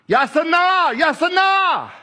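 "Yasa na" sung out high, stress on "na"; Hebrew word said out. Shown as a demonstration. Preacher demonstrating the Hebrew "yasa na", high and ringing. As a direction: lift "yasa na" high and ring the "na". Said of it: "Hosanna in the Hebrew".

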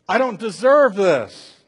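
loud, high, drawn-out indignation; Job protesting. Acted. Job refuting his friends' belief, loudly indignant. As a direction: protest it slowly at full voice. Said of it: "this is what Job keeps refuting".

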